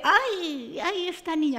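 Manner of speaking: a hint of a pitying old neighbor; old lady voice. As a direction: sigh it like a fussy old lady. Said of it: "Me dice".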